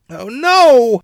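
high, loud cry of alarm; scream. A narrator's reading. Mother running after the mob, screaming. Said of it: "Mother screamed".

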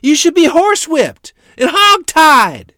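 high, loud outraged shriek. A narrator's reading. Furious mother threatening punishment, shrieking. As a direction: scream the threat at him. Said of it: "Mother shrieked at him".

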